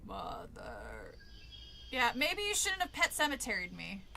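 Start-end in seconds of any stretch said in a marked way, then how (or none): 0.0-1.1 very low, soft ghostly "Mother"; hollow undead groan; creepy low moan. Acted. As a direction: call her from the grave.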